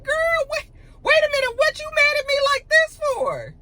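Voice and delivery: very high-pitched imitation of disbelief; baffled protest. Tia caught off guard by Jazzy's attack, high baffled protest. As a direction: squeal it in mock bewilderment. Acting out Tia's startled protest.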